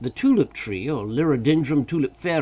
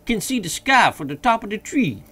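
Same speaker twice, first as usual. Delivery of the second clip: high dialect voice calling down; raised servant voice from the treetop; Jupiter's voice; 9 semitones higher, 7 dB louder than usual. A narrator's reading. Jupiter answers from tree.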